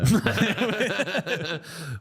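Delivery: high-pitched laughing fit; burst of laughter. Real, not acted. Laughing at co-host's joke about hearts, high laughter. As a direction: crack up laughing.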